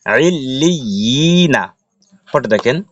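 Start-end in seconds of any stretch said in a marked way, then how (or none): 0.1-1.6 আইল লি ই না with the long i held; drawn-out madd. Shown as a demonstration. Teacher joining the word after the rule, lengthened recitation. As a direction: stretch the ই before a short না. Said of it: "এক লিপ টেনে পড়তে হবে".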